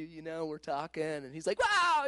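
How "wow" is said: high, drawn-out excited wow; imitated thrilled exclamation. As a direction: mimic his excitement, pitched way up. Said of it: "he's all excited".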